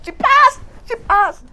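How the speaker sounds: high squealing joy, near a shriek; mother's delighted shriek; excited squeal. Retold or imitated. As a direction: squeal it out like a proud mother hearing good news. Mother squealing at exam results.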